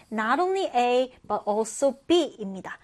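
not only A but also B said slowly; slow model phrase. Shown as a demonstration. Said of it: "기본 표현이". (천천히 또박또박)

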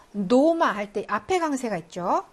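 usual speaking voice

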